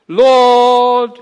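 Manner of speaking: a big loud booming call; mock pulpit boom. A narrator's reading. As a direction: boom it out like a preacher at prayer. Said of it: "boomed Alec".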